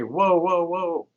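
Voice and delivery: mild retold interjection to halt a caller; interrupting. Acts out stopping an abusive caller.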